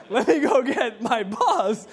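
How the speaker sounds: loud high laugh, then slow laughing words; cracking up, barely getting the words out; burst of laughter. Real, not acted. Pastor cracking up as he recalls fetching his boss.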